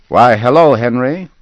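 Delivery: bright, loud, friendly hello; cheerful greeting. A narrator's reading. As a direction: call out a hearty hello.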